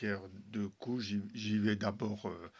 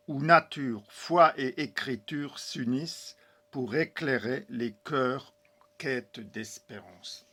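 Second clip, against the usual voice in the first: back-cover blurb read aloud; 3 semitones higher, 8 dB louder than usual. Shown as a demonstration. Author reads his back cover to viewers, louder, solemn and uplifted. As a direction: proclaim it like an inspired book presentation. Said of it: "je vous lis le petit texte derrière".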